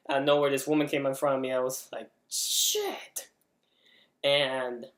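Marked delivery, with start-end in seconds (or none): 2.3-3.3 high, strained mock yelp; squeaky retold cry of fright; startled yelp. Retelling a sudden jump scare.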